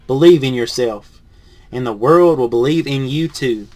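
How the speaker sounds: lively picture-book read-aloud; reading to children. Acted. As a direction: read it to a class of kids.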